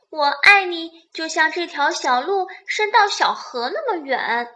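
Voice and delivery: high, loud story-character call; little rabbit calling out. A narrator's reading. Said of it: "小兔子又喊道". (小兔子高声喊道)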